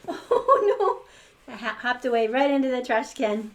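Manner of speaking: loud, high laughing oh no; excited giggly exclamation. Real, not acted. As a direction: burst out laughing at the mishap. Reader laughing at the hat.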